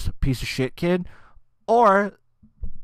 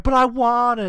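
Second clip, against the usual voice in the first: mock whiny kid; 9 semitones higher, 5 dB louder than usual. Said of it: "kid's just like".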